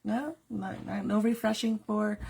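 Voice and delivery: usual speaking voice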